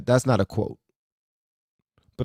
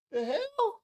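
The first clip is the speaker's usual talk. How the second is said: tiny, very high squeak, much softer; baffled disbelief; 11 dB quieter than usual. Real, not acted. Host reacting to an insulting clip, baffled. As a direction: squeal it quietly in bewilderment.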